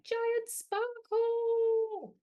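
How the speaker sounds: very slow, high, loud drawn-out call; giant sparkle. Shown as a demonstration. Storyteller leading kids, slow big call. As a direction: stretch it huge and slow. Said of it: "You do a giant sparkle".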